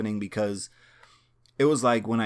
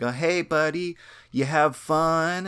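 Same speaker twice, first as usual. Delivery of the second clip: higher, lightly voiced cheery mom; mom's cheerful greeting; 6 semitones higher than usual. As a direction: chirp it like a sunny mom greeting her son. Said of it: "my mom would be like".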